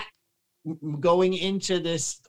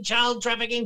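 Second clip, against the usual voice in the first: ordinary talk, nothing marked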